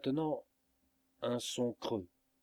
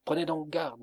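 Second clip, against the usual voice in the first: higher and louder, a sharp warning cry; cried out warning; 5 semitones higher, 4 dB louder than usual. A narrator's reading. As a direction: shout a warning to the old man. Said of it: "m'écriai-je".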